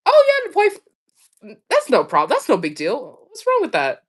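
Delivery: casual friends. Retold or imitated. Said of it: "they're like".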